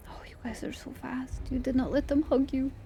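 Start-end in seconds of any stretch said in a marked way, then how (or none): 0.1-1.4 soft unvoiced whisper. Real, not acted. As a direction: hush it to a whisper. Whispered aside to the game.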